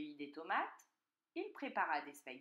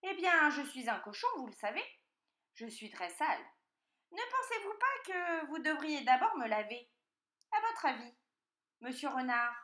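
higher, lively piglet voice; wheedling piglet; 6 semitones higher, 7 dB louder than usual. A narrator's reading. Piglet asking the fox for a bath, wheedling. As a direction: coax as an artful little pig.

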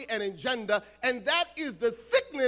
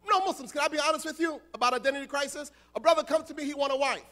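ordinary talk

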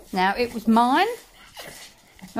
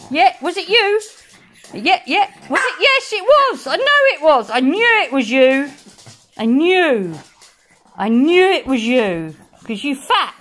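triumphant accusation; 4 dB louder than usual. Real, not acted. Owner catching the guilty dog, loud mock triumph. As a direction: crow it, you caught them.

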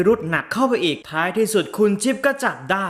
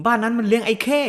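urgent warning; 6 semitones higher than usual. Retold or imitated. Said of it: "เสือก็ห้ามใหญ่แล้วว่า".

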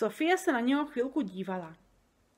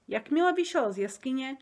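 ordinary delivery, nothing marked

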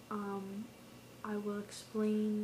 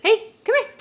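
excited high voice; 9 semitones higher, 20 dB louder than usual. Real, not acted. Playful talk to a baby or pet.

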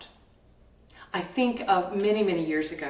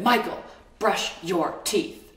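slow, louder and hammered out; mother slamming the counter, raging; furious order; 4 dB louder, slower than usual. Retold or imitated. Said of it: "I said, ... I was so angry".

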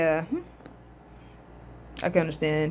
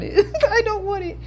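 high voice through giggles; laughing refusal; 13 semitones higher than usual. Real, not acted. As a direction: protest while cracking up. Laughs while refusing the thought.

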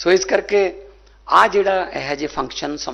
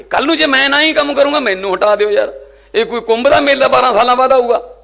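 higher, louder challenge to the crowd; rally shout; 7 semitones higher, 5 dB louder than usual. Real, not acted. Politician shouting a challenge to the rally crowd. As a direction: hurl the question at the crowd.

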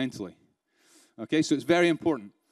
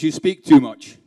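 a firm, louder quoted rebuke; louder with the mentor's blunt verdict; 9 dB louder, slower than usual. Retold or imitated. Speaker repeats his mentor's blunt verdict, firm and louder.

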